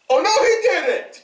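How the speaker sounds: high, fast cry of disbelief; a guy in the back gasping out loud; shocked cry. Retold or imitated. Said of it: "who just went".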